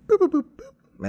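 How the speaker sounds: high robotic droid beeps; beep boop. Retold or imitated. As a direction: chirp like a little cleaning droid. Just making some droid noises.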